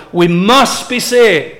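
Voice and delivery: slow, with "must" drawn out firmly; emphasis on MUST. Shown as a demonstration. Preacher hoping listeners capture it, stressed MUST. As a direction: press "must" home, slowly. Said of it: "I hope you capture that".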